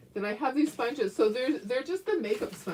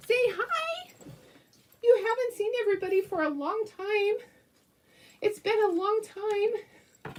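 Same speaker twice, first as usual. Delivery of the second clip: much higher pet-talk voice; 11 semitones higher than usual. Real, not acted. Owner coos at her dog, showing her to viewers. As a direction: gush at the little dog.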